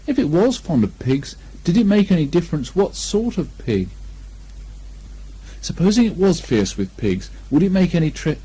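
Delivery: usual speaking voice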